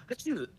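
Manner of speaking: high, loud mock sneeze; imitated sneeze. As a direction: act out a child's sneeze. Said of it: "she goes".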